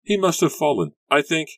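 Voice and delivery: high, loud, halting; frightened stammer. A narrator's reading. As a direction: stumble through it in shock. Rebecca still frightened.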